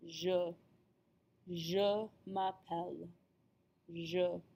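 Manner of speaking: "Je" said slowly as "zhuh"; careful French "Je" with rounded vowel; practice model. Shown as a demonstration. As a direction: model "Je" alone and in a slow "Je m'appelle". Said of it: "make sure that you practice that".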